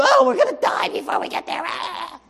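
high, fast, shouted despair; whining Israelites. Acted. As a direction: wail out the doom in a panicky rush.

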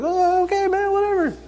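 squeaky high, dismissive "oh okay, whatever"; mock high-pitched shrug. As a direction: pitch way up in a bored brush-off. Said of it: "and I was like".

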